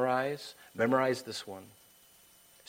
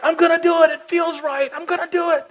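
very high, loud mock frenzy; reckless impulse, acted; 11 dB louder than usual. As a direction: shout it giddily in a put-on high voice. People giving in to impulse.

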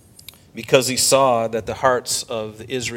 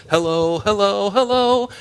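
rising hellos; 8 semitones higher, 3 dB louder than usual. Acted. Preacher calling out to congregation.

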